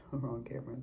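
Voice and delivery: laughing whispered aside. Real, not acted. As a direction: breathe it under a laugh. Aside about the wrong camera.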